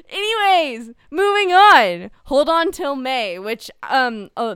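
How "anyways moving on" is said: loud, high exasperated sigh-talk. Real, not acted. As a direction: push past it, fed up. Annoyed host cuts off banter.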